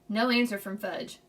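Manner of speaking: ordinary delivery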